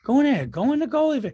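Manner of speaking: high, urging call to go in goal; cheering on a nervous kid; excited coaching. Retold or imitated. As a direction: hype the kid up to get in there. Dad cheering daughter into goal.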